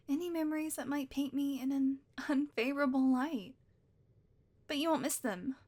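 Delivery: ordinary talk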